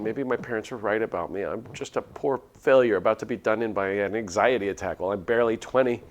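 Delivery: ordinary delivery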